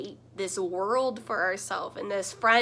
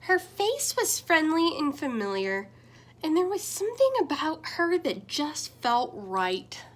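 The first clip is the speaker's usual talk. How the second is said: storybook reading. A narrator's reading. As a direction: read it like bedtime story time with little ones. Reads picture book aloud.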